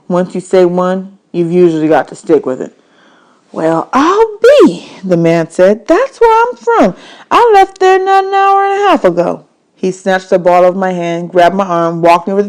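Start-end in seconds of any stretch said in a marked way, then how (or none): 3.5-4.9 high, very slow amazed drawl. Acted. Man's voice in audiobook. 5.8-9.4 much higher delighted man voice; pleased to share a hometown; the man's voice. Acted. Man's voice in audiobook.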